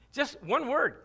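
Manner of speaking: ordinary talk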